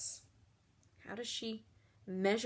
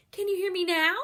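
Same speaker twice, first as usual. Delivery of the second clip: higher and louder, almost calling; 8 semitones higher, 8 dB louder, faster than usual. Shown as a demonstration. Teacher plays with volume, ending loud. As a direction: belt it out to the back row. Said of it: "see if we can have some fun".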